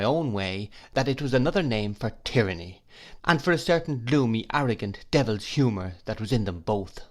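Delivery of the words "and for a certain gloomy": ordinary delivery, nothing marked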